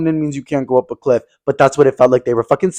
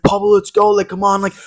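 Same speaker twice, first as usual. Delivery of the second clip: higher pushy friend voice, lightly done; voicing Oscar; 6 semitones higher than usual. Friend Oscar urging Pablo to hike, pushy and higher. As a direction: hype him up like a pushy friend.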